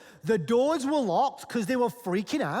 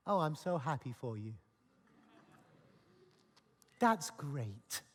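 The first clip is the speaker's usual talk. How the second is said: soft, low, dry sarcasm; sarcastic congratulations; 8 semitones lower, 9 dB quieter than usual. Retold or imitated. Thomas sulkily answers the excited disciples, soft and sarcastic. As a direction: mutter the congratulations through sulky disappointment.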